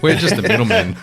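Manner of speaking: high laughing protest. Real, not acted. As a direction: laugh through the words. Laughing defence.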